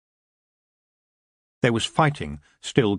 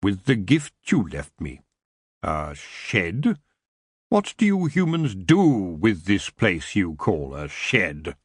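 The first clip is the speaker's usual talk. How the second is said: slow, lowered, curious king; a hint of the elf king turning the word over; the elf king puzzling over a human shed; intrigued elf king; 4 semitones lower, slower than usual. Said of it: "He sounded intrigued".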